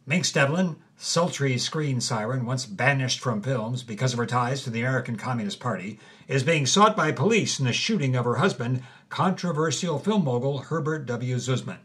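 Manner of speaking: usual speaking voice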